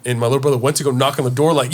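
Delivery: ordinary delivery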